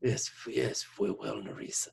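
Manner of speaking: soft, husky smoker's voice; smoker's rasp. Retold or imitated. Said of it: "He just gets Smoker's voice".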